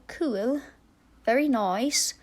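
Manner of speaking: light mock New York-style accent; put-on accent. Vlogger playing with accents over the build, mock accent. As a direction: playfully slip into a put-on accent. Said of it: "I've been talking a lot of accents lately".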